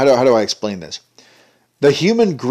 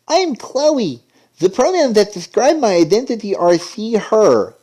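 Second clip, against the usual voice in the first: much higher mock sweet girl voice; mocking girly voice; 9 semitones higher than usual. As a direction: parody a cartoon girl introducing herself. Mocking a cartoon character.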